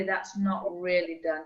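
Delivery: ordinary talk, nothing marked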